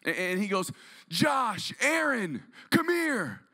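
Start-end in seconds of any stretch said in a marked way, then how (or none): 1.1-3.4 put-on higher calling out to the kids; mild mock yell, higher; dad yelling. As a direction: call the boys over from the stands. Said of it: "my dad yells".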